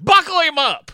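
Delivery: high, loud barked order, mimicking a grouch; imitated gruff yell. Old ride worker orders a parent, imitated yell. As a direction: holler it like a fed-up ride operator. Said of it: "oldest man that hates children".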